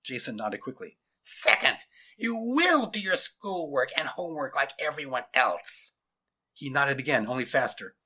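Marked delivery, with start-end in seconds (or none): 1.2-5.9 high, strained, strangled order; scratchy, forceful command; demanding scratchy voice. A narrator's reading. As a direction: lay down the law in a scratchy voice. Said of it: "demanding, scratchy voice".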